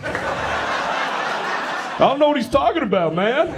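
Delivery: high-pitched mock bewilderment; mock clueless local. Mimics clueless local in crowd.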